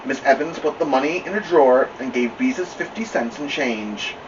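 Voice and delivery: ordinary delivery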